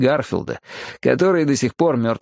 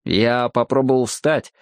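ordinary delivery, nothing marked

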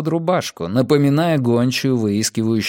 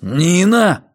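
raised-pitch, reproachful cry; warning growl; 5 semitones higher, 4 dB louder than usual. A narrator's reading. Matthias rebuking Nina for her remark, growled warning. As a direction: snap the name as a rebuke. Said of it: "прорычал Матиас".

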